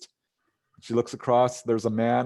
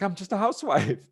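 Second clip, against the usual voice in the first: modest housewife; 9 semitones higher than usual. Retold or imitated. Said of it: "And she's like".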